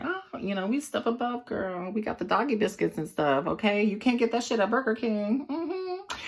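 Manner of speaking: high sassy put-on voice; high-pitched mock smugness; sassy bragging. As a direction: brag in a high, sassy girlfriend voice. Boastful sassy character voice.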